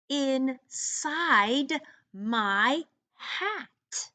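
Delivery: very slow, spaced read-along; slow choral read. Shown as a demonstration. Teacher reading the poem slowly so students can read along. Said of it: "read it with me".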